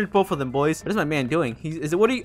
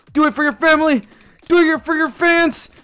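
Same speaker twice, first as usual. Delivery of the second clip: mock hype; 9 semitones higher, 6 dB louder than usual. Cheering himself on.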